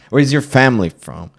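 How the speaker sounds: mock insistent questioning. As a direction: keep pressing like a pushy stranger. Mimics questioner pressing further.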